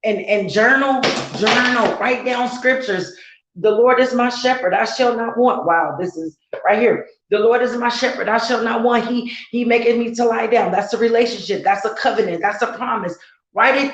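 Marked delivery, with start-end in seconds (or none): 3.5-5.7 reciting scripture. Acted. 7.3-10.7 reciting scripture. Acted.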